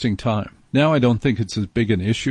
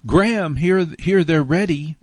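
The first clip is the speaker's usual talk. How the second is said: higher pretend call to someone in the next room; lightly acted holler, pitched up; mock calling out; 6 semitones higher than usual. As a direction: call the name out, but only half shouting. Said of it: "you shout into the locker room".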